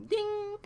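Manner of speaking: high, drawn-out ding; imitated ding. Storyteller miming the big moment, high comic ding. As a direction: sing out a high little bell noise.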